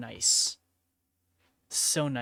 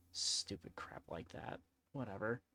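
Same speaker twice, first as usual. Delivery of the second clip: whisper; 5 semitones lower, 13 dB quieter than usual. Real, not acted. Streamer grumbling to herself about the rude viewer, whispered. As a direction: breathe it out under your breath.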